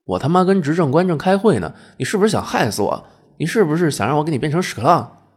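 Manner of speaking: high, loud, exasperated rant; furious telling-off. Acted. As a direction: rage at him for interrupting. Angel furious at being interrupted.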